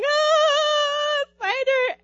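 high, sing-song wail; whimper-wail about the spider; lightly hammed-up frightened wail; mock scream. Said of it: "screaming and sitting on the sink".

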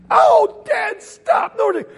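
very high comic cry for mercy; mock pained yelp. Brother begging dad to stop spanking, high mock yelp. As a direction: yelp as if being strapped.

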